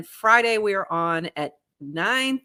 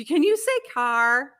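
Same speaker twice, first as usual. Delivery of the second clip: mimicked askers, higher and slower; slow, higher prompt, imitating nosy requests; people asking; 7 semitones higher, slower than usual. People kept asking her to say things, pestering imitation. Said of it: "they kept asking me to say things".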